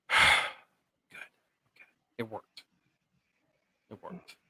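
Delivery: breathy relieved whisper, barely voiced. [name] whispering to herself as Sam seems fine. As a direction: whisper it like the danger passed.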